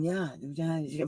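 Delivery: soft mock agreement; fake conversing. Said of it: "fake, all fake conversation".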